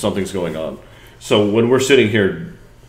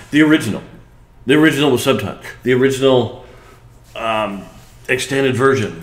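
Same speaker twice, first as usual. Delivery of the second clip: excited; 5 semitones higher, 4 dB louder than usual. Real, not acted. Listing horror movies excitedly.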